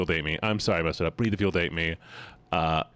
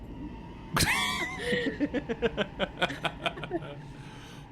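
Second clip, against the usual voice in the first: shrieking laugh; 5 dB quieter than usual. Real, not acted.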